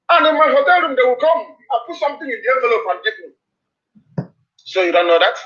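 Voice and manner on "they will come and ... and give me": normal talking voice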